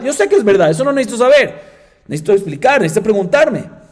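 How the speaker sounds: high, loud indignant tirade; exasperated complaint. Retold or imitated. Teacher scolding new student who only agrees, exasperated.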